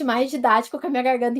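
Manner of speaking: ordinary talk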